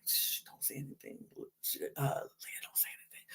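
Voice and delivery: hushed, voiceless mock whisper; imitating a whisper. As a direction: whisper it like shushing a friend. Janae hushing Leah, retold.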